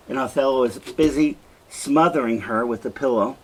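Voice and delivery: ordinary talk, nothing marked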